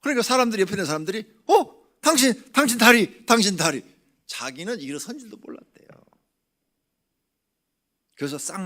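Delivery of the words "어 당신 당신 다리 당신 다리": much higher, louder amazed cries; astonished bystanders. Retold or imitated. Bystanders seeing the cripple stand, loud astonished cries. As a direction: gasp and point at his legs.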